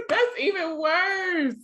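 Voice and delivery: high, slow laughing reply; laughing at how bad it is. Real, not acted. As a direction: laugh through the words. Laughing reaction.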